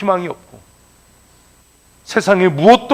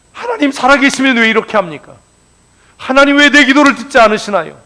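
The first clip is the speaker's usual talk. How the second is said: loud, raised cry of complaint to God; lamenting complaint; 8 semitones higher, 6 dB louder than usual. Retold or imitated. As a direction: wail the protest at heaven. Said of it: "라고 탄식하지 말고". (하나님께 따지는 큰 탄식)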